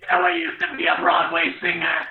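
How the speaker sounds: raspy smoker voice; a heavy smoker's rasp. Acted. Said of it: "Should I do my smoker voice?".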